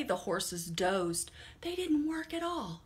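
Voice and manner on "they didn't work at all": puzzled farmer. Acted. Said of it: "Farmer said".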